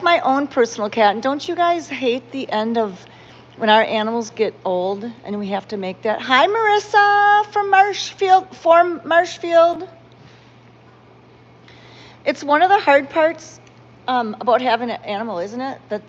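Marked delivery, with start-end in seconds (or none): 6.2-9.9 bright, much higher, slow and drawn-out hello; cheerful greeting. Real, not acted. Greeting Marissa from Marshfield in the live chat, high and cheery. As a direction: sing out a welcome to a viewer.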